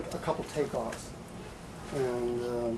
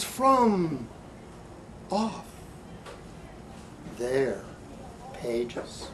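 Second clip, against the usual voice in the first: quieting poem ending; 4 semitones higher, much slower than usual. Acted. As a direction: bring it back down to a reflective close.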